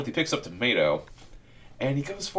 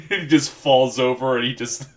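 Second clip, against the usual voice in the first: talking through laughter; laughing; 4 semitones higher, slower than usual. Real, not acted. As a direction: crack up while telling it. Cracking up mid-story.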